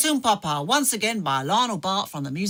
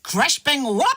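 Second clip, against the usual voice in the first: punchy exclamation; 5 dB louder than usual. Acted. Blurts song title like noises.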